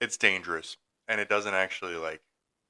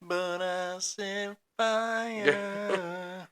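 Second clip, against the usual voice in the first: mock [name]; 11 semitones higher, 4 dB quieter, much slower than usual. Mimicking [name] in a high voice.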